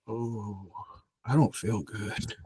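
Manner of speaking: very soft, slow, fading voice; barely there, slow and soft; faint and weak. Retold or imitated. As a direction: let the words drain away as if about to pass out. Retold self fading.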